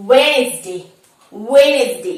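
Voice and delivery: loud Wednesday as wenz-day, first D silent; correct pronunciation. Shown as a demonstration. Teacher introducing word number three, correct pronunciation. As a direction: punch Wednesday with no D in the middle. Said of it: "the correct spelling is Wednesday".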